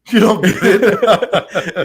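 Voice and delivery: real laugh while echoing the punchline. Real, not acted. Host echoing the grandfather's punchline, laughing slowly. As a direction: repeat it slowly through laughter.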